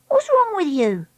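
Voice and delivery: put-on high-pitched, pert girl; prying Treacle. Treacle noticing Dakota's trembling lip, high and prying. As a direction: ask it high and whiny.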